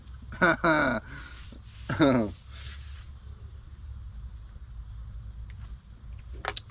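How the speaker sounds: breathy amused chuckle. Real, not acted. Laughs at wife's remark.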